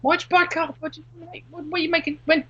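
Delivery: high, mocking voice firing questions; mock incredulous questioning. Mocking a friend who keeps plastic on the carpet, high and incredulous. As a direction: lampoon the plastic-cover owner.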